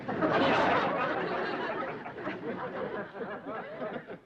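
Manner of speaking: long high breathy wheezing laugh; long cackle. Real, not acted. Kin laughing at the overcharmed boy, long wheezing cackle. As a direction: let the laugh roll on and on.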